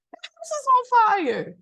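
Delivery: high, loud exasperated burst; exasperated cry. Real, not acted. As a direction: yell the obvious in disbelief. Exasperated punchline about burning house.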